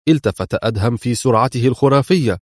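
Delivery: usual speaking voice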